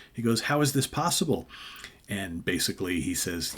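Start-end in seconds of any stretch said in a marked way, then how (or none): 0.4-1.5 higher-pitched, acted bewilderment; mock disbelief. Killer faces a man he killed, mock disbelief. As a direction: question it as if stunned. Killer stunned by dead man's return.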